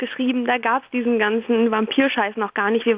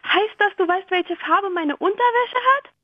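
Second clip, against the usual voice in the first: put-on alarmed girl; 9 semitones higher than usual. Girl alarmed by mind-reading lion.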